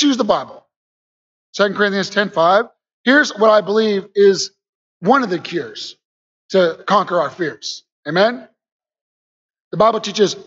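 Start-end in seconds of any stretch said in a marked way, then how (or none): none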